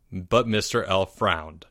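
ordinary delivery, nothing marked

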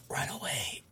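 hushed, voiceless warning; whisper. A narrator's reading. Narrator voices Toge warning his classmate, whispered. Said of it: "hears Toge whisper".

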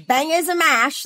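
high, botched British accent sliding Southern; British gone Southern. Shown as a demonstration. Traveler showing off a bad British accent that sounds Southern. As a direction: attempt British and miss toward Southern. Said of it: "that sounds Southern".

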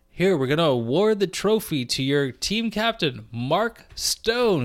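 high, booming trophy announcer. Pretend. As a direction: announce it like a ceremony host. Mock trophy presentation announcement.

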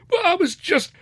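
very high, squeaky protest; put-upon youngster's high-pitched excuse; protesting son. A narrator's reading. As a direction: try feebly to get a word in. Son protests, mouth full.

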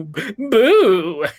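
high, loud ghost boo; ghostly boo. Shown as a demonstration. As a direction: belt out a spooky boo. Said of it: "They go".

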